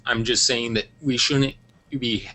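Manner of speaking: usual speaking voice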